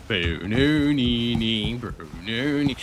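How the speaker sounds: drawn-out nonsense drone; boo-noo-nee-nee dragged slowly; half-sung mock droning; slow mock chant.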